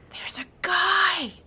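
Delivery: high, strained stage whisper; urgent whisper. Acted. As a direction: whisper it loudly and slowly in alarm. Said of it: "she whispered".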